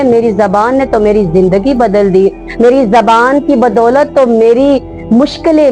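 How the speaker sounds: normal talking voice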